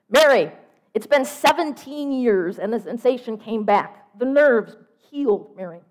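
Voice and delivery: loud sister pressing the point home; loud talker. Acted. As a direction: proclaim the news loudly, stretching the words. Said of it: "talks loudly at all times".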